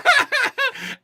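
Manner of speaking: high-pitched laughter; high laughter at his own joke; high giggle. Real, not acted.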